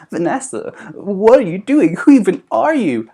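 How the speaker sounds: high, loud incredulous friends; high shocked friend voice; mock friends. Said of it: "all her friends are gonna be like".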